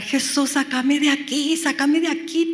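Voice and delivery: high-pitched mock cry for rescue; imitated desperate shouting. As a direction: act out a prisoner crying out to Jesus. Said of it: "él empezó a gritar".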